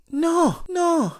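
very high, squeaked refusal; high indignant denial. Retold or imitated. To friend calling him dramatic, very high indignant denial. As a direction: squeak the no in outrage.

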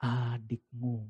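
very soft, slow adikmu, barely voiced; gentle correction. Father gently handing the brother back to the elder son. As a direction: say adikmu softly and slowly, lowering the tone. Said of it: "Dia kembalikan".

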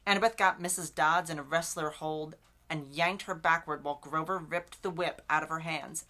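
ordinary talk, nothing marked

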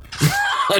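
very high burst of laughter; sudden high laugh. Real, not acted. Cracking up at John's advice to Vince, laughing.

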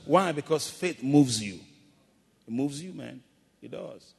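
normal talking voice

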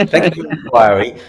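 normal talking voice

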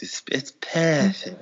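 hushed, eerie imitation of her saying "perfect"; breathy perfect whisper. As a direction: whisper "perfect" softly, like a creepy purr. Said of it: "she loves saying perfect".

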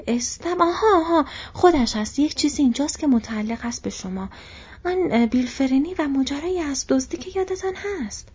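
a flustered host finally remembering the news; flustered innkeeper. Acted. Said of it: "مری گفت".

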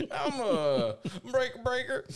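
CB radio call. Pretend.

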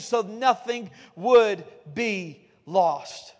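very slow, weighty drawing out; preacher slowing to a solemn crawl; slow emphatic refrain. Acted. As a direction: let each word land slowly. Sermon refrain slowed for weight.

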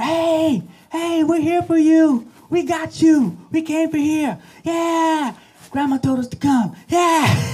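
high, loud cheering from the stands; family cheering. Retold or imitated. Family in the stands shouting to the girl, high and loud. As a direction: yell support across the pool.

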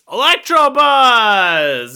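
Electrobuzz! yelled high, loud and stretched out slowly; excited shout. Acted. Remembering the name of his card, yelling Electrobuzz! high, loud and slow. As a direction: cry out Electrobuzz! like a triumphant discovery. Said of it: "It's Electrobuzz".

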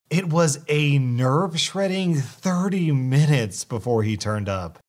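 ordinary delivery

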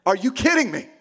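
mildly exasperated, indignant outburst; a touch of angry disbelief in full voice; acted anger. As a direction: throw the question out in mock outrage. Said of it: "I am so angry right now".

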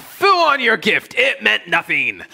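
high, loud, slow scornful shout; dismissive mock shout. What the husband's reply sounded like to his wife, scornful shout. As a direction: fling the words back with contempt. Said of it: "which kinda came across like".

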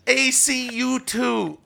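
slow, drawn-out mock Australian-Irish accent. Sidekick speaking for Philip about his favourite band, slow Australian-Irish accent. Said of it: "That's the Australian Irish".

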